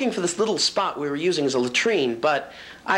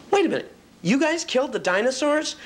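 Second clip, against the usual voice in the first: startled question; 9 semitones higher than usual. A narrator's reading.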